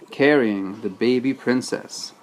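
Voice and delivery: ordinary delivery, nothing marked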